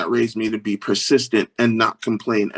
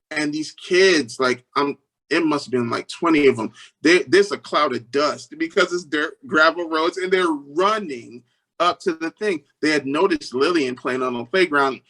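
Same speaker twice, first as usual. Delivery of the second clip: ordinary talk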